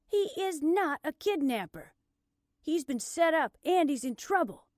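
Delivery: high, loud insistence; indignant protest. Acted. Blair springing up to defend her friend, indignant. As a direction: hit each point forcefully.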